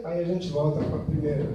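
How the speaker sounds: ordinary talk, nothing marked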